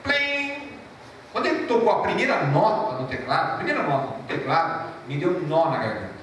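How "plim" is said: high sung plim imitating a keyboard note; preacher imitates the keyboard note; keyboard plink. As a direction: chime it out like one note. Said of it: "fez assim".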